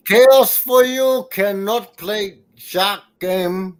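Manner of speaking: slow, emphatic wrestler taunt; hammed-up wrestler with accented broken English; Iron Sheik. Wrestler mocking a gamer, slow Iron Sheik voice. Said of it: "I am Iron Sheik".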